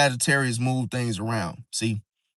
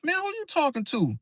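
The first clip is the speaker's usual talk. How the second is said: high-pitched indignant comeback, softer; offended retort; 11 semitones higher, 6 dB quieter than usual. Retold or imitated. Passenger snapping back at a rude driver, indignant and high.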